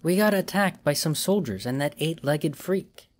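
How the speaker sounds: Rosie's voice. A narrator's reading. Rosie speaks in captivity.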